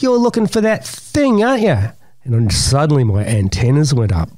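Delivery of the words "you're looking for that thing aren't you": put-on high, knowing kid's voice; boy accusing the stranger, high and knowing. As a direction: tease him like you know the secret. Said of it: "He goes".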